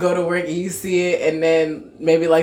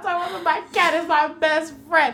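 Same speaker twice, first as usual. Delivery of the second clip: mock outrage; 10 semitones higher than usual. Real, not acted. Ranting about the store.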